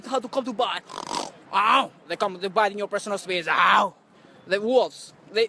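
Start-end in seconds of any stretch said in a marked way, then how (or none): 1.5-1.9 a loud, hammed-up "ow" as if bitten; mock yelp of pain. Pretends the wolves bite him. 3.5-3.9 a loud, hammed-up "ow" as if bitten; mock yelp of pain. Pretends the wolves bite him.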